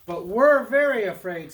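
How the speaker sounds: high, loud anxious goat voice; high-pitched storybook worry; afraid little goats. Acted. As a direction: protest as frightened little brothers. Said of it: "said his brothers".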